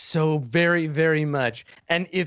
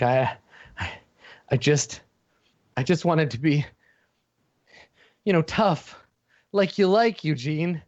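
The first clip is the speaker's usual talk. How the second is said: tearful little villain; 4 semitones higher than usual. Acted. Furry villain pleads with Eugene, close to tears. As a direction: beg him to understand, eyes full of tears.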